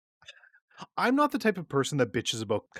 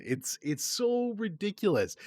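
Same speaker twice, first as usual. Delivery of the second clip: usual speaking voice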